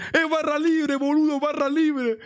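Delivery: high-pitched drawn-out imitation of a friend's yell; mock excited yell. Friend with two drinks announcing free bar, mock excited yell. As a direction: imitate an overexcited friend yelling, high and stretched.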